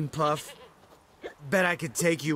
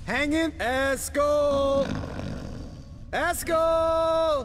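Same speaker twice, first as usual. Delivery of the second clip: calling out; 9 semitones higher, much slower than usual. Acted. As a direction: stretch the call out to him. Calling to friend nearby.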